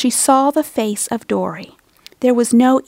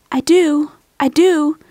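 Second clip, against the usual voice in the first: higher, louder, voice cracking with emotion; voice breaking; 6 semitones higher, 4 dB louder, slower than usual. A narrator's reading. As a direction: hold back tears and lose it. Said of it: "Dory's voice broke".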